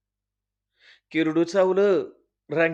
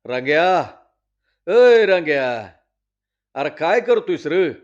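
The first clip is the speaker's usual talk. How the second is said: loud call across the yard; loud village call; 7 dB louder than usual. Acted. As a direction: patil hollering for Rangya. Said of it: "पाटलानं हळी दिली".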